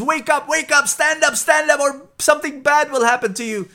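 high, loud, panicked little pig voice; shrill alarm, squeal it out to wake your friend; frantic pig. Acted. Pig begs his sick friend the horse to stand up, high frantic squeal.

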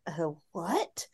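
a barely voiced, baffled aside; stunned whisper. Viewer spotting the look-alike brother, baffled whisper. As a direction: whisper it like you can't believe your eyes. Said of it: "I was like".

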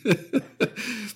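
high soft embarrassed laugh; choked-up laugh. Real, not acted. Host laughing at getting emotional about Endgame. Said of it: "pretty tough for me".

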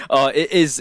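normal talking voice

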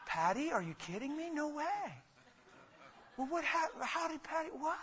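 imitating a shocked friend, rising to high; playing the gasping sympathizer who can't believe it; mock disbelief. Pastor mocking a shocked counselor, disbelieving. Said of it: "Man, I wouldn't be like".

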